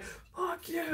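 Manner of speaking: high, soft squeaky curse; mock road rage. Curses at another driver in road rage. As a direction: tosses a tiny curse at a driver. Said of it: "I'm like".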